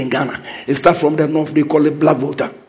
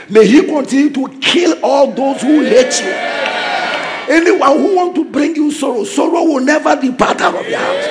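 preaching at full force; 8 semitones higher than usual. Real, not acted. Preacher shouting blessings over the church. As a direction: declare it over the congregation. Preacher rising into prophetic declarations.

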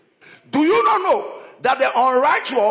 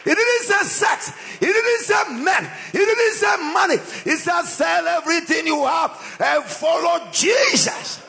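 fiery preaching; 9 semitones higher than usual. Acted. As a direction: thunder at the sinners. Preacher shouts a rebuke.

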